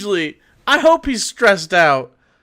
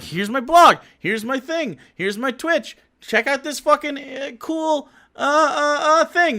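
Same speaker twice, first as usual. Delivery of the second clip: mock self-promoter; 8 semitones higher than usual. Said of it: "Everybody's like".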